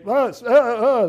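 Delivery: high stammering panic; mock freaking out. Pastor imitating demons freaking out at Jesus, high and flustered. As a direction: stutter like frightened demons. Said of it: "they're freaking out".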